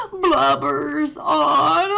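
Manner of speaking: mock-crying, high and loud; blubbering. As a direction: read it while pretending to sob. Bear cries.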